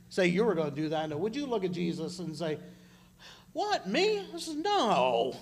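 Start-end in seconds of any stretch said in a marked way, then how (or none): 3.5-5.4 high, slow incredulous "What, me?"; mock surprise. Peter protesting loyalty to Jesus, mock surprise. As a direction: play it as stunned disbelief.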